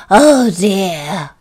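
loud, gasped exclamation; gasped dismay. Acted. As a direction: gasp in sudden dismay. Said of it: "he gasped".

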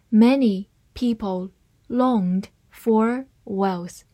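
slow, careful reading of each word. Shown as a demonstration. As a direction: read it slowly for learners. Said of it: "慢慢来读". (慢速朗读)

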